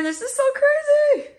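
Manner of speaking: amazed. Real, not acted. Overwhelmed by chaotic packing.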